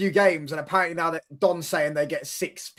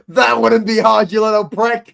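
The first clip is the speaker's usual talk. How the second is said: mock rant, loud and high; Jimmy; 6 semitones higher, 8 dB louder than usual. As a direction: imitate an angry friend, loud and drawn out. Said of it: "where he goes".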